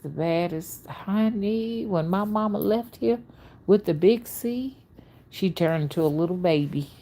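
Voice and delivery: normal talking voice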